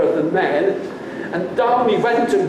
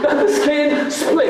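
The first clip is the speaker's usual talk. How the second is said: laughing prompt; 8 semitones higher than usual. Shown as a demonstration. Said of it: "I was hoping for some help here".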